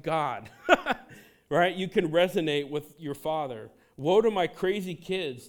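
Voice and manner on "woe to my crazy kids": louder, higher booming proclamation; playful divine decree, louder; declares the Lord. Retold or imitated. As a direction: pronounce it like an exasperated father. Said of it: "declares the Lord".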